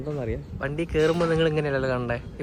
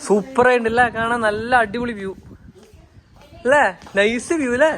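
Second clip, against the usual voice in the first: high, loud delight at the view; excited; 10 semitones higher, 8 dB louder than usual. Real, not acted. Vlogger showing viewers the resort view, gushing loud and high. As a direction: gush over the view.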